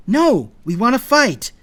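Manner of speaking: high, loud defiance; loud, insistent protest; arguing back. A narrator's reading. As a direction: argue back forcefully. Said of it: "Jack argued".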